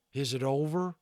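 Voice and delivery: lightly voiced cowboy calling out; Boone calling. A narrator's reading. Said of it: "he called".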